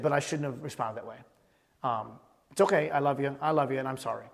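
low, soft retold apology; subdued, sincere and low; gentle apology. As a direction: apologize quietly to a child. Retold apology to his son.

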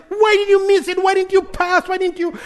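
high, loud frustrated questioning; upset dad. Retold or imitated. Dad scolding son after a missed chance. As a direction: scold the kid for missing. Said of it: "I'm like".

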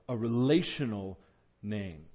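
normal talking voice

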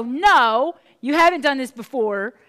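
loud, whiny petulant protest; petulant child. Retold or imitated. Balaam retorts to his talking donkey, loud childish whine. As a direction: snap back like a sulky child. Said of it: "petulant little child of a seer".

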